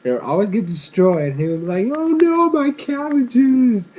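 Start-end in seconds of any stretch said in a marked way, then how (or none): 1.9-3.9 high-pitched, drawn-out cartoon lament; mock despairing wail. Cabbage seller seeing his cart destroyed, mock despairing wail. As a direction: wail it loudly like a hammed-up distraught merchant.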